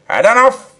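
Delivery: breathless, loud, high outburst; panted shout. A narrator's reading. Professor to the narrator, a panting loud outburst. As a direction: pant it out as a loud cry. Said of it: "he panted".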